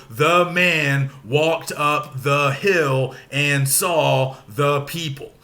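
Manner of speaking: very slow, evenly separated words; syllable by syllable. Shown as a demonstration. Teacher showing students how to count out syllables, slow and separated. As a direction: measure out each syllable at half speed. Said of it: "break it down into the individual syllables".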